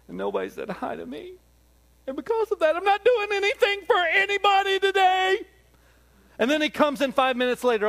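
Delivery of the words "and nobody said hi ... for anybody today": high, loud whiny complaining God; petulant God impression. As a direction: pout like an offended God who got snubbed. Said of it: "It would go a little something like this".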